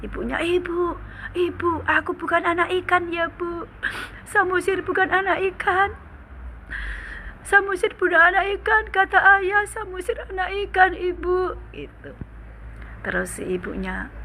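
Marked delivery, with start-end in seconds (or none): 0.4-11.6 put-on high, tearful little boy pleading with mother; child sobbing to his mother; crying child. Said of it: "sampai nangis".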